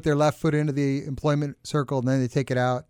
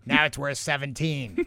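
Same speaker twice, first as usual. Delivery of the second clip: pirate voice. Pretend. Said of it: "talk in a pirate voice".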